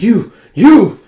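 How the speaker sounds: loud, high exclamation; indignant outburst. Acted. Acted outburst at Huck.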